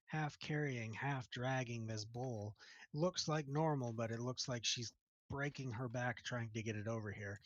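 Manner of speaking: ordinary talk, nothing marked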